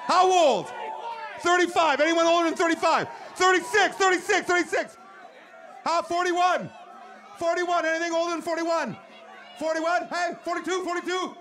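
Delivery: loud, high crowd calling. Real, not acted. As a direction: shout over the crowd for answers.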